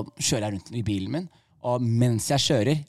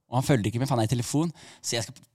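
normal talking voice

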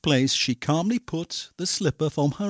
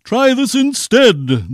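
loud, slow, raised retort; storybook prince's roared comeback; yelled back; 9 semitones higher, 11 dB louder, slower than usual. A narrator's reading. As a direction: yell it back forcefully. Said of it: "the prince yelled back".

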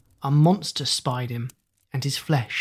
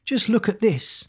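put-on boy's cry; 6 semitones higher than usual. A narrator's reading. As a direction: show it off in a boy's higher voice. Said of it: "cried the boy".

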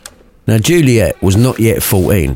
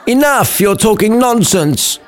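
much higher exasperated cut-in; Romeo interrupting; 12 semitones higher than usual. Acted. Romeo cutting off his mate, high exasperated voice. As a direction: cut him off, fed up. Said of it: "Romeo interrupted".

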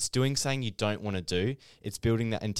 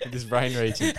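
amused, words riding on a laugh; 5 semitones higher than usual. Real, not acted. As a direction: talk through laughter. Laughing at the lump joke.

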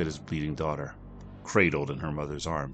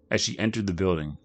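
ordinary delivery, nothing marked

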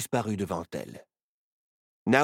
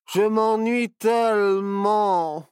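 put-on bored boy; 10 semitones higher, 6 dB louder, much slower than usual.

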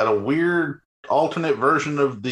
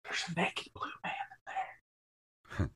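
hushed whisper; 2 semitones higher, 16 dB quieter, much faster than usual. Real, not acted. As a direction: breathe it out as a secret. Hushed aside about the scene.

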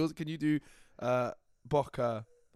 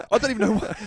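ordinary talk

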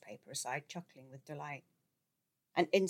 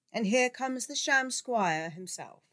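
slow, higher, grand announcement; grandly; 8 semitones higher, 7 dB louder, slower than usual. A narrator's reading. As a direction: announce him like a herald, slowly. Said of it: "Professor McHugh said grandly".

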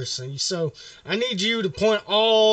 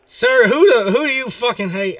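louder, higher burst at the clerk; loud demanding question; 5 semitones higher, 6 dB louder than usual. Real, not acted. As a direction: blurt it out, pushing for an answer. Prank caller demanding hate.

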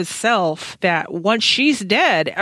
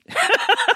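high laugh. Real, not acted. Amused by the answer.

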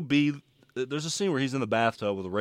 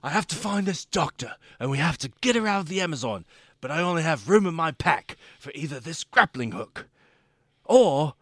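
higher-pitched adventurer in earnest peril; Bear Grylls; 4 semitones higher than usual. Retold or imitated. Friend imitating the show's host posing a choice, dramatic. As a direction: imitate a British survival-show host, stretching the final choice. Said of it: "the live-action Bear Grylls".

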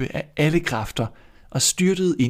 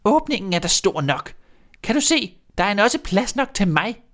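much higher, louder witch voice; shrill-voiced impatient witch; 10 semitones higher, 3 dB louder than usual. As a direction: show her scornfully as a witch. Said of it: "sagde heksen".

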